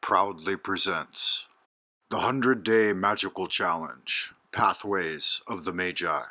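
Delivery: low, softly spoken show introduction; announcer intro. Real, not acted. Host welcoming listeners to a short guided meditation, calm announcer read.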